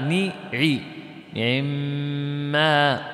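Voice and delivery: نعم broken down as ni, 'i, then long mma; ikhtilas. Shown as a demonstration. Teacher breaking down ikhtilas, syllable then slow chant. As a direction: give the ayn in نعم a brief i, hold the m. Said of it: "الاختلاس تقول".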